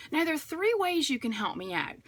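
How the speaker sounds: ordinary delivery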